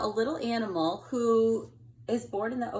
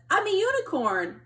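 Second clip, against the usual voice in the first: higher, louder proud little voice; proud reveal; 10 semitones higher, 7 dB louder than usual. Acted. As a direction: announce it proudly. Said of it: "He says".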